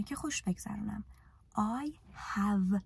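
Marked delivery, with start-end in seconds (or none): 1.5-2.8 drawn out word by word for learners; very slow, spelled-out model sentence. Shown as a demonstration. Teacher models an English example, very slowly. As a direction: slowly lay out each word so students can copy. Teacher drills four tenses.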